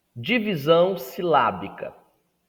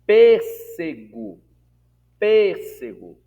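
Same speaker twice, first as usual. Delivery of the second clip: Pêssego slow, PÊS loud and high; Pêssego with a loud, raised first syllable, then falling away; stress on first syllable; 4 semitones higher, 9 dB louder, much slower than usual. Shown as a demonstration. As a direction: punch the PÊS of Pêssego hard. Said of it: "antepenúltima sílaba".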